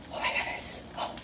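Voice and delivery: hushed, voiceless amazement; awed whisper. Real, not acted. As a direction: whisper it as if stunned by the food. Awed at the cooked food.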